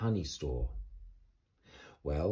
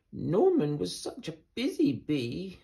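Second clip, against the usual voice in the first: sighing Amelia; 9 semitones higher than usual. Acted. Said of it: "sighed Amelia".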